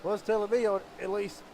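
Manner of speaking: excited high race call; motorsport commentator. Real, not acted. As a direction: call the close finish with energy. Calls the photo finish.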